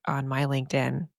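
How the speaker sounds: normal talking voice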